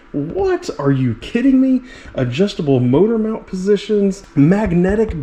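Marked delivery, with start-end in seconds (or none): 0.1-1.8 high-pitched, playful astonishment; mock disbelief. Reviewer amazed by a car's adjustable wheelbase, mock disbelief. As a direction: react as if the specs are too good to be true.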